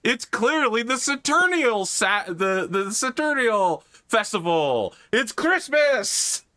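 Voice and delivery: high, slow squeaky mock voice; squeaky know-it-all fan; mock community voice. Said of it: "the community was like".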